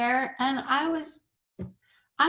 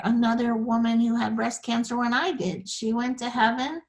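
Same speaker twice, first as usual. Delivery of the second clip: ordinary delivery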